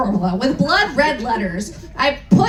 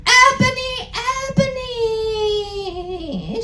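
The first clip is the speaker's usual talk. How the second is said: high, drawn-out mock-tragic wail; hammed-up sobbing call; sad shout; 8 semitones higher, slower than usual. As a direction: sing the name out like a heartbroken goth. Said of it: "shouted Draco sadly".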